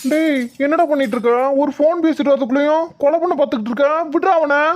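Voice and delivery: high-pitched, urgent telling-off; alarmed scolding. Acted. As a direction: break up a fight, exasperated. Friend rushes in to stop fight.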